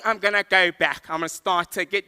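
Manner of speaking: acted nervous driver; flustered panic. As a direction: give in, rattled and loud. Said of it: "I'm panicking".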